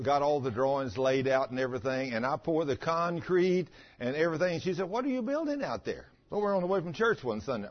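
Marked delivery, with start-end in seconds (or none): 4.9-6.1 higher-pitched imitation of a puzzled wife; wife's questioning voice. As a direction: mimic her wanting to know, pitched up. Wife questioning him in retold scene.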